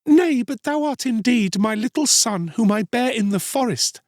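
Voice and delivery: high, pleading woman's cry. A narrator's reading. Mother claiming her lost son, crying out. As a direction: plead urgently, pitched well up. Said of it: "she cried".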